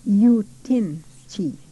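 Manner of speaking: Yutinchi drawn out slowly, each syllable clear; teacher sounding out Yutinchi; slow careful Cree. Shown as a demonstration. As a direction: spell out Yutinchi slowly for students. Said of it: "Is it windy?".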